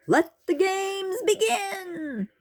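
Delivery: high, slow squeal of a pig. A narrator's reading. A pig squeals to start the mud games, high and drawn out. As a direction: stretch it into a piggy squeal. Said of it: "someone squealing".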